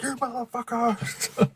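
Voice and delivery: high, slow mock outburst at the maga crowd; mock rage. Streamer imitating himself ranting at maga talk, mock rage. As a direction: act out losing it, half-joking. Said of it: "I'm just like".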